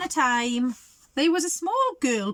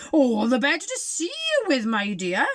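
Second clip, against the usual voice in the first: mock growl; 3 semitones higher, 4 dB louder than usual. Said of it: "growled the wolf".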